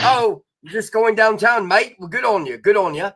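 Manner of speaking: Australian accent played for laughs; hammed-up Australian accent. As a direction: put on a cheeky Australian bloke accent. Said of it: "I would act like I'm Australian".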